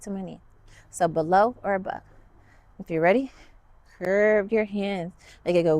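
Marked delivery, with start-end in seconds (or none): none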